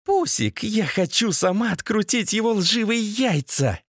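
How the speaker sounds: put-on very high girlish voice, eager; predatory woman. A narrator's reading. Masha begging her Pusik to let her torture, high-pitched. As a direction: squeal it out with bloodthirsty relish. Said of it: "Хищно облизнувшись, продолжила это бестие".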